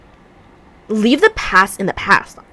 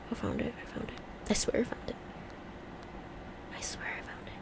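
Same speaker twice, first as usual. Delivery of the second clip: hushed unvoiced whisper; whispered to herself; 25 dB quieter, faster than usual. Real, not acted. Swearing she found the clip, whispered. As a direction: mutter it under the breath. Searching phone.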